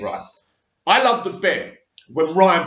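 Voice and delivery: ordinary delivery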